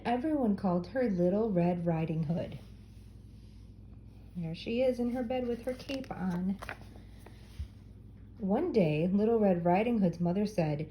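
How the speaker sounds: ordinary delivery, nothing marked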